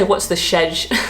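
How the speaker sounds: schedule cut short to "shedge"; British short form. Shown as a demonstration. Joking that you can't say this, British short form. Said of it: "But you can't say".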